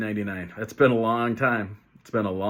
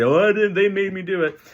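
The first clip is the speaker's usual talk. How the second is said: put-on high, whiny kid denying blame; whiny kid excuse; 9 semitones higher, 4 dB louder than usual. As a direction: play a kid wriggling out of blame. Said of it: "You ever have a kid say".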